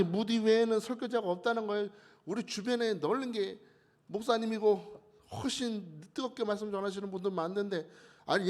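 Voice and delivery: confrontational protest. Acted. Young pastor challenging the revival meeting organisers, indignant protest. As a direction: argue back hotly as an objecting young pastor. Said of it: "막 따지는 사람이 일어났다는 겁니다".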